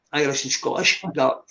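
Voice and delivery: normal talking voice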